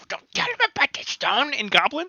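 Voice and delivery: small mage goblin hushing his chief; goblin warning. Acted. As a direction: nudge and warn him. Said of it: "elbowing in the ribs".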